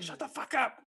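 high but held-back mock yell; imitated kid's yell. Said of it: "Cohen will yell up to him".

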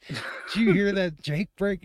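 high, loud excited question; excited radio call. Retold or imitated. Trucker on the radio asking about the noise, high and loud. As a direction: ask it high and loud in disbelief. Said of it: "And he goes".

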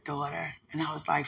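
normal talking voice

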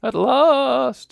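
high, airy ghost voice. Acted. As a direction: play a weary old ghost. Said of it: "says the ghost".

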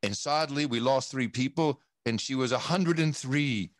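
normal talking voice

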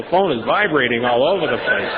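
ordinary talk

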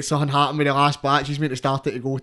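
ordinary talk

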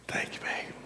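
barely voiced, whispered thanks; meek whisper. Husband thanks his flattering wife, in a meek whisper.

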